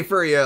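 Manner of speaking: "fur ya" with a reduced vowel, like "fer"; regional pronunciation. Shown as a demonstration. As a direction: say "fur ya" the Pacific Northwest way. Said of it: "We do say".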